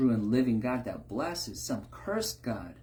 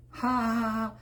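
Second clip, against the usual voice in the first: mock laugh; 11 semitones higher than usual. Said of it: "Those are the jokes".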